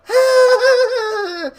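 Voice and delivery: very high, loud cackling laugh; a gleeful old beggar's loud cackle; shrill laugh. Acted. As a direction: throw the head back and cackle. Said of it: "lets out a shrill laugh".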